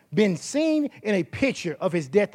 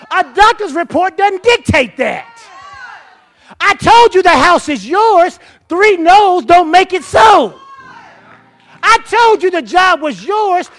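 higher, louder, slow shouted declaration; shouted preaching; 6 semitones higher, 13 dB louder than usual. Acted. Preacher voicing Jesus to the congregation, shouting. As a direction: declare it over them at full force.

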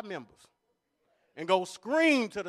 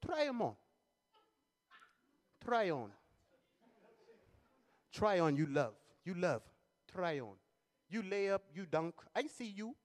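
salesman voice; 2 semitones lower, 9 dB quieter than usual. Retold or imitated. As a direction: coax like a pushy shoe salesman. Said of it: "He said".

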